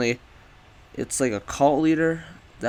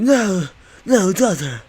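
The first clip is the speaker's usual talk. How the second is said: mock plea; 9 semitones higher, 11 dB louder, slower than usual. Pleading with the possessed daughter.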